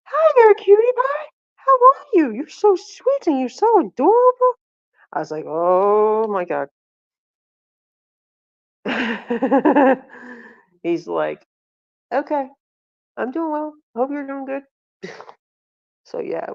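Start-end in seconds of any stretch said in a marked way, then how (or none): 0.0-4.5 very high, loud squealing gush; gushing flirty mom. Retold or imitated. Mom gushing at cute doctor. 5.5-6.7 loud, slow, drawn-out disbelief; mock mortified exclamation. Mortified by mom's flirting. 12.1-14.6 much higher-pitched bashful reply; imitated flustered doctor. Flustered doctor answering gushing mom.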